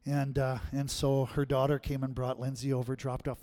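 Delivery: ordinary talk, nothing marked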